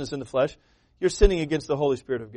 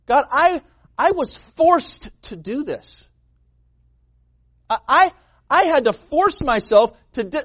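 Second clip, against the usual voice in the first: whiny excuse-maker; hammed-up pleading excuse to God; mock excuse; 6 semitones higher than usual. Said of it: "the worst thing ... is say".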